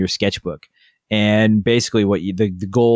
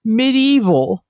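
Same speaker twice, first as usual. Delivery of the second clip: put-on whiny kid voice, much higher. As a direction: whine it like a sulky kid. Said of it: "real high whiny voice".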